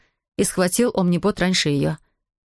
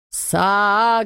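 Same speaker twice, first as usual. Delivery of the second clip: alien creature crying out; a mild yell in a strange creature voice; creature's yell; 2 semitones higher than usual. A narrator's reading. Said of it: "завопило существо".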